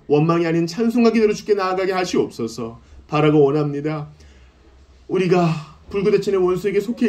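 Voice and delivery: impassioned preaching prayer rising to cries; fervent prayer. Acted. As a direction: pray aloud over the congregation, pleading. Leads congregation in prayer.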